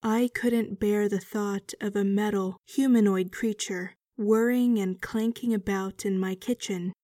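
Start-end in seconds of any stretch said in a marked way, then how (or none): none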